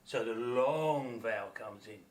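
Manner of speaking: ordinary talk, nothing marked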